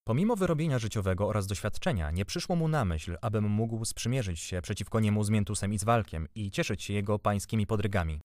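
usual speaking voice

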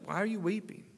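high, gentle voice of the angels at the tomb; angel asking. Retold or imitated. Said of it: "they's like".

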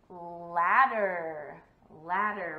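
LADDER stretched out and loud; lingering, emphatic Ladder; drawn out. Shown as a demonstration. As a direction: present Ladder slowly and emphatically. Teacher presenting word for children.

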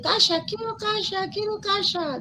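put-on high, slow child saying "casa" with a sh sound, "kasha"; child's voice. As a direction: imitate a little kid asking for a house. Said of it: "Los niños dicen".

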